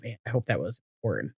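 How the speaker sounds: soft, low self-talk; muttered inner thought. Retold or imitated. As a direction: mutter it to yourself. Retold thought, much quieter.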